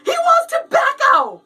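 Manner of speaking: high, drawn-out cry of comic terror; terrified. Acted. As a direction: wail it to the driver in mock terror.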